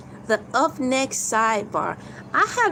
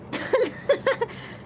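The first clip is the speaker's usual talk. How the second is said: high laugh. Real, not acted.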